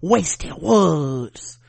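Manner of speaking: creepy Southern stage whisper; whispery Southern accent. Retold or imitated. Host mimicking the mad mother, whispery Southern accent. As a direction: imitate a menacing whispery Southerner. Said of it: "that's how she was sounding".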